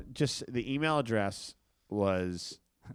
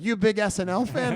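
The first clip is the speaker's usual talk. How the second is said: higher, louder teasing question; mock taunting; 11 semitones higher, 5 dB louder than usual. Comedian teases Danny about SNL, raised voice. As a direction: needle the stranger. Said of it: "And I was like".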